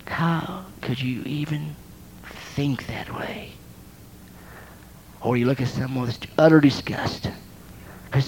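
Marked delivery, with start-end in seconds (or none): 0.0-3.5 slow, whispered disapproval; quietly wounding a spouse; slow hushed rebuke to a friend, much softer; cold hushed rebuke. Acted. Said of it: "you say".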